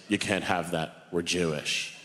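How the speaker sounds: a hint of a stern old grandfather.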